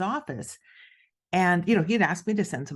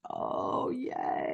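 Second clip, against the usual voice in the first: breathy half-whispered fake cheer; sarcastic whispered yay; 7 semitones higher, 5 dB quieter than usual. Author answering mentor's jab with a whispered sarcastic cheer. Her deflated reply.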